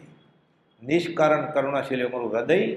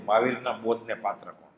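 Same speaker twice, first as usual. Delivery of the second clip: quiet reading of the line; 6 semitones lower, 7 dB quieter than usual. Shown as a demonstration. Preacher quietly reads the letter's question to the congregation. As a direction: read the question much lower and softer. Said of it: "ગલ્લેથી લખ્યું".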